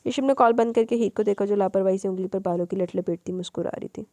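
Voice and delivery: usual speaking voice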